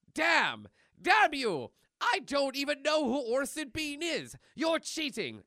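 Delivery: high, loud cartoon tantrum; hammed-up, childish outrage; indignant outburst. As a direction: protest like a sore loser at tag. Acted quarrel over a tag game.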